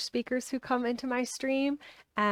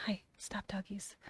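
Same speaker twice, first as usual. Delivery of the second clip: whispering; 12 dB quieter than usual. Real, not acted. Hushing her dogs off-mic.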